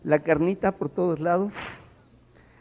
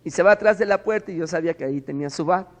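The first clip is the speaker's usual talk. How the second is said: ordinary talk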